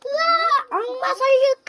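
high, loud panicked shriek; screaming in fear. Pretend. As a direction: shriek in terror at the demon. Shrieks at finding the talisman.

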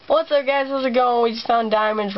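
high, bright intro greeting; excited intro voice. Acted. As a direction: hype up the viewers. Vlog intro greeting again.

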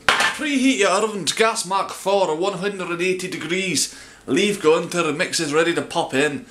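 hammy put-on Scottish; imperfect Scottish accent. Baker listing ingredients to viewers, put-on Scottish accent. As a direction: keep up a strange Scottish accent. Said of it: "strange accent through the whole of my video".

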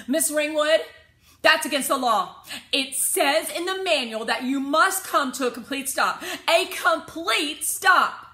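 put-on high, loud, preachy kid; know-it-all kid scolding the driver; lecturing child. A narrator's reading. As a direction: spell out the law, slowing on the last words.

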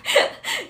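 high laugh; a quick laugh. Real, not acted. Laughing at how Shanghainese the name sounds.

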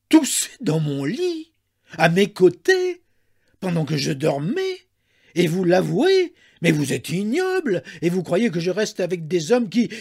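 loud, high, scandalised tirade; outraged, each phrase flung out; fresh anger. A narrator's reading. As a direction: burst out in offended fury. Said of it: "un sursaut de colère nouvelle".

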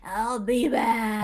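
slow low movie robot threat; deadpan Terminator line. Retold or imitated. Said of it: "All right, Terminator".

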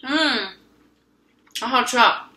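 chewing while talking; muffled by food; mouth full. Real, not acted. Seen 0: bite taken at -2, mouth closed and working through the words.

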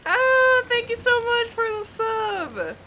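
high, loud, thrilled gratitude; excited thanks. Real, not acted. Thanking a subscriber.

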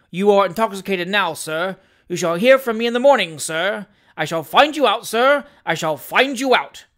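loud, insistent threats; indignant. Acted. Little doctor threatening the stranger, indignant. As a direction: hammer out each outraged warning. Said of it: "said the indignant little man".

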